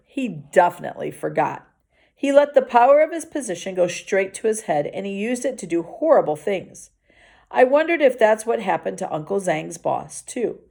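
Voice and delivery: plain reading voice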